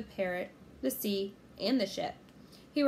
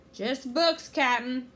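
loud reply, pitched up; raised crewman's shout; 4 semitones higher, 7 dB louder, slower than usual. A narrator's reading. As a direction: holler the report down to the captain. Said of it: "shouted Stinky".

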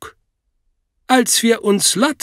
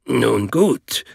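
knurrend, widerwillig; 6 semitones lower than usual. A narrator's reading. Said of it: "knurrte Troy eher widerwillig".

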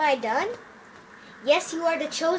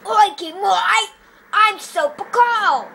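loud, higher cartoon voice, slowed; silly put-on voice; 4 semitones higher, 6 dB louder, slower than usual. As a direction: stretch it out in a goofy character voice. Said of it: "other voices like this voice".

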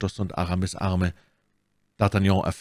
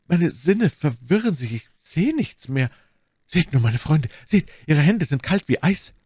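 high, distressed appeal; alarmed; 7 semitones higher, 3 dB louder than usual. A narrator's reading. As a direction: cry out in mounting alarm. D'Artagnan in alarm over the woman. (aufgeregt und bestürzt)

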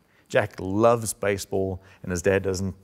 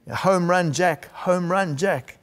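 higher chant of home run; cheering crowd; 8 semitones higher, 3 dB louder, slower than usual. Retold or imitated. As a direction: call it like pirates in the stands. Pirates cheering at the match.

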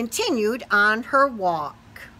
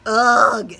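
squeezed ugh; 9 dB louder than usual. Acted. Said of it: "said the bug".

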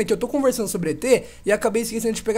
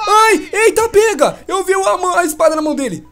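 high, loud, startled cry; alarmed shout; 9 semitones higher, 7 dB louder than usual. Real, not acted. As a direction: yelp at the danger. Spots the murderer. (grito assustado)

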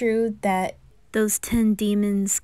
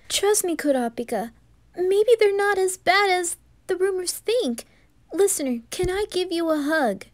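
put-on much higher, pleading kid voice; pleading boyish Gon; 8 semitones higher than usual. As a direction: beg earnestly for a hug, voice up high. Gon pleads to hug spirit.

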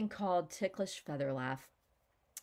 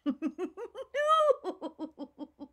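high, louder burst of laughter; real laughter; 11 semitones higher, 5 dB louder than usual. Real, not acted. Laughter coach cracking up while stretching her smile. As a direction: let the laugh spill out. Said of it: "laughter, as you can tell, is always okay".

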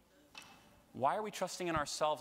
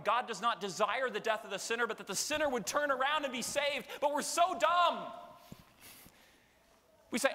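ordinary talk, nothing marked

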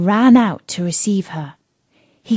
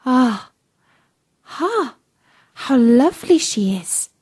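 higher-pitched gasp of admiration; admiring murmur of guests; 5 semitones higher than usual. A narrator's reading. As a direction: sigh in admiration as an awed onlooker. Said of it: "a confused noise of".

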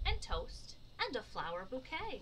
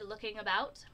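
plain reading voice

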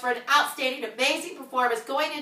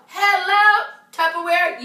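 excited greeting; 6 semitones higher, 8 dB louder, slower than usual. Real, not acted.

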